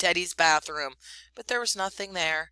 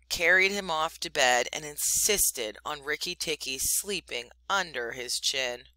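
usual speaking voice

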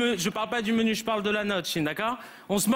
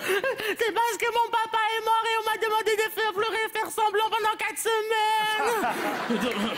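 put-on high, sobbing little girl; pitched-up weepy whimper, dragging at the end; crying child voice; 8 semitones higher, slower than usual. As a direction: blubber it out through fake tears. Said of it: "Pourquoi tu pleures comme ça?".

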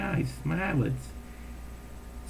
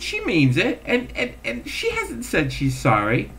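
laughing retort; 9 semitones higher than usual. Retold or imitated. Teller laughing while retorting.

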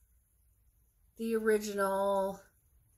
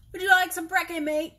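loud, higher-pitched attempt at an Australian accent; terrible Australian accent; 7 semitones higher, 7 dB louder, faster than usual. Offering breakfast to a mate, terrible Australian accent. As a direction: call it out in a badly faked Australian accent. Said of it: "that's terrible accent".

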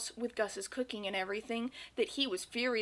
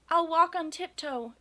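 high little mouse voice; meek mouse; 6 semitones higher, 5 dB louder than usual. A narrator's reading. Mouse promises Gus to behave, meek high voice. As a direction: promise obediently in a raised, small voice.

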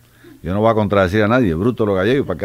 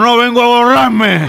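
slow, high, loud holler; acted drunk shout; 13 semitones higher, 6 dB louder, slower than usual. Drunk at the door answers the meeting, slow shouting. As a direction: holler the answer back, stretched out.

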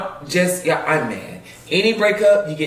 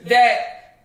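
ordinary delivery

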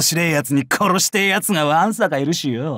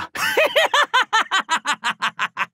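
very high, long falling cackle; wild laugh. Acted. Warrior amused by his own bloodstains, wild cackle. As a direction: cackle gleefully in a high staccato run.

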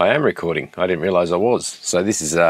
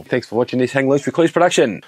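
announcer voice; 3 semitones higher than usual. Shown as a demonstration. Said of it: "That's how you do a voiceover".

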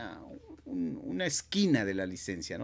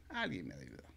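resigned mutter; 10 semitones lower, 12 dB quieter, faster than usual. Retold or imitated.

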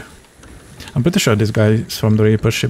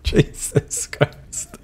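exasperated whisper; 4 semitones higher, 6 dB quieter, much slower than usual. Real, not acted. Exasperated at pushy teammate.

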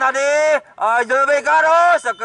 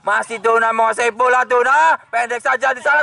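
ordinary talk

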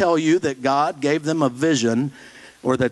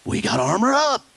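higher glib catchphrase; mock churchgoer; 7 semitones higher than usual. Pastor imitating a churchgoer's empty slogan, higher. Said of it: "how do you use it".

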